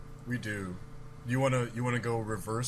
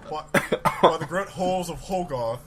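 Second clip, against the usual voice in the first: louder, higher hammed-up English accent; shitty English accent; 3 semitones higher, 9 dB louder than usual. Shown as a demonstration. Retrying silly Hogarth line for co-hosts, hammy English accent. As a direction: overdo a bad English accent, barely holding it. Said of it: "in my shitty English accent".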